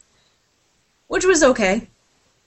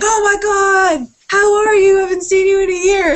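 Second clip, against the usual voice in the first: much higher and louder, gushing surprise; excited greeting; 12 semitones higher, 5 dB louder than usual. Retold or imitated. Will Anderson greeting her at Scarborough, high gushing delight. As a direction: gush it like bumping into an old friend.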